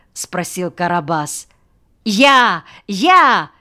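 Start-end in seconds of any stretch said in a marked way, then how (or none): 2.0-3.5 high, loud calls of pupils; excited children piping up; eager volunteers. A narrator's reading. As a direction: shout to be chosen.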